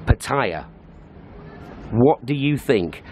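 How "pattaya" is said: PATTAYA as puh-TIRE, short first syllable; pronunciation three. Shown as a demonstration. Vlogger showing a popular way, Pattaya as puh-TIRE. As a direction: say Pattaya like puh plus car tyre. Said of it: "Number three".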